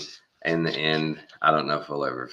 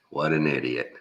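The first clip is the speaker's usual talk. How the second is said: normal talking voice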